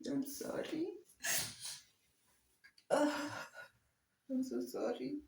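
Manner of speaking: very high, tearful apology; real crying. Real, not acted. Apologising to viewers after breaking down, crying. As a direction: break down and squeak out an apology through sobs. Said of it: "I'm really trying not to cry".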